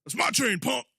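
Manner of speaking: a hint of a menacing bully; tough-guy line, mildly growled; Debo quote. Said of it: "as Debo in the Friday series".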